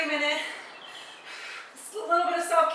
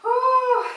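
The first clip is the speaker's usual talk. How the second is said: loud exhale; 10 semitones higher, 8 dB louder than usual. Real, not acted. Breath out during workout.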